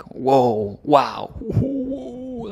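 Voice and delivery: high, drawn-out whoa and wow; mock amazement. Teacher acts out a Danish whoa, mock amazed. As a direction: stretch out a wondering whoa. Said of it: "or if we're like".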